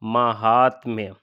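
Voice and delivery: महात्म्य drawn out slowly with a long मा; slow careful spelling. Shown as a demonstration. Teacher checks the vowel for students, saying the word very slowly. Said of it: "क्या माँ में बड़ा की मात्रा लगेगी?".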